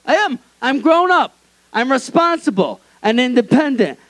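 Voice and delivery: high, whining defence of being an adult; whiny grown-up protest. Retold or imitated. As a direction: whine it like a sulky son. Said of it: "And I'm like".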